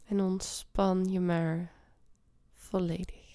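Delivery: guided meditation. Acted. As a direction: ease them into calm. Leads a meditation.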